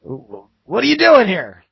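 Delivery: loud, high, drawn-out challenge; a baffled guard calling out slowly; confused guard. Acted. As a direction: raise pitch and volume in bewilderment. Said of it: "they look confused".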